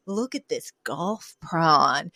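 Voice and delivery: slow, low, reverent admiration; hushed mock awe. Fran holding up a large shrimp, mock reverence. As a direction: savor it slowly, softly, as a treasure.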